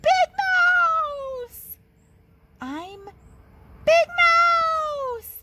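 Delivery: high, loud scared yell; frightened yell. A narrator's reading. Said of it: "he yelled".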